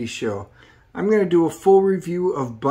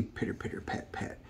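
hushed footsteps sound, no voice at all; whispered footsteps; 19 dB quieter than usual. Acted. Reviewer voicing the girl's footsteps, whispered. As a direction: whisper it, barely there.

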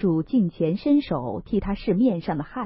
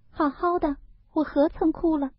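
put-on much higher girlish voice with a forced little smile; 9 semitones higher than usual. Daiyu to Baoyu, denying her tears with forced cheer. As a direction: brush it off, pretending she hadn't cried. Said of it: "便勉强笑道".

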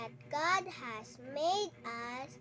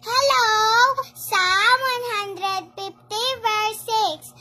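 memory verse called out loud; loud proud verse recital; loud recitation; 12 dB louder than usual. Real, not acted. Children shouting memory verses.

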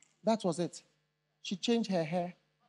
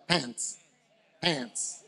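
American accent; 6 semitones lower, 7 dB quieter than usual. Shown as a demonstration. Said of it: "Sorry about my American accent".

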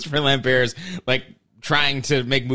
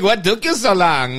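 put-on weirdo Swiss accent; 5 semitones higher, 4 dB louder than usual. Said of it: "he just sounds like a weirdo".

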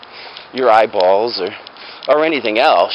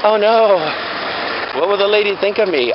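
higher mock fretting voice; lightly raised, worried daughter; imitated worried daughter; 7 semitones higher than usual. Daughter fretting after the salon, higher mock voice. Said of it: "she was like".

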